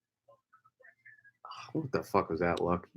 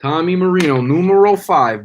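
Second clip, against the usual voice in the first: announcer call; 5 semitones higher, 6 dB louder than usual. Acted. Announces pick again.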